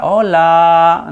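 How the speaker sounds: called out as if echoing off a canyon; echoing hola. Shown as a demonstration. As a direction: call it out, let it ring back. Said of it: "Así, con un eco". ("Hola" llamado como eco)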